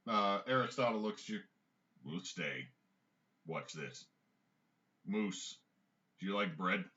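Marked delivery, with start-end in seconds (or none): 2.0-6.8 a hint of a dog character, low and understated; lower, softer voice for a dog; lightly voiced dog, pitched down; talking sled dog.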